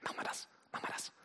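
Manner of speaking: soft unvoiced whisper; a quiet inner voice whispering. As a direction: hush it like a secret nudge. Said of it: "so leise Stimme".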